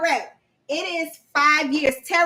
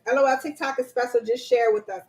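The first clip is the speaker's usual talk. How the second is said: ordinary talk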